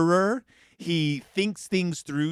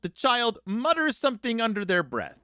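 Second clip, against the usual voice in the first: ordinary delivery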